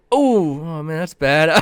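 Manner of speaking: hammed-up awkward condolence; awkward sympathy. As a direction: stumble into clumsy pity. Awkward reaction retold, high and loud.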